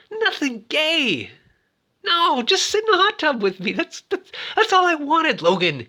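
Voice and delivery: high, hammed-up wounded plea; pleading Francis. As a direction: whine like a jilted friend. Francis voiced pleading about hot tub.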